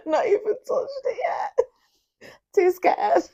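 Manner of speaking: squealing laugh through the words; high-pitched giggly panic. Real, not acted. Laughing about the hot tea.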